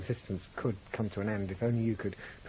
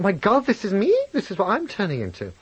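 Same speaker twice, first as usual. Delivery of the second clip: retold alarm, high and louder; horrified realisation; 11 semitones higher, 6 dB louder than usual. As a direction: re-enact a dawning shock at oneself. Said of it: "And I thought".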